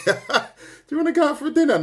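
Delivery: high, laughing mock invite; laughing tease. Teacher laughing while teasing Wendy about dinner. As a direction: giggle through the invite.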